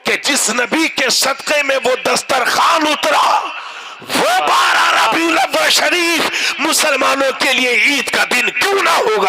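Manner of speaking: sermon building to a high, shouted peak; impassioned pulpit shout; climactic preaching shout. Real, not acted. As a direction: drive the argument up to a crowd-rousing cry. Sermon climax drawing cries of praise.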